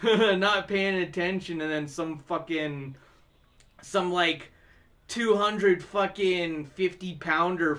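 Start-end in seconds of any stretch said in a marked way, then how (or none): none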